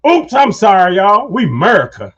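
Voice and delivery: hammed-up country accent for a crowd; country boy accent. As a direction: holler it in a thick country accent. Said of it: "I kept that country boy accent going on".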